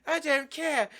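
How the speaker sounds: high-pitched put-on complaint; hammed-up exasperated boss; character impression. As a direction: whine it in a mock high voice. Acts out Veruca's father's factory talk.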